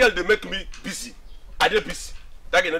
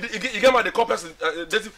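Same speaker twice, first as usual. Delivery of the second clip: normal talking voice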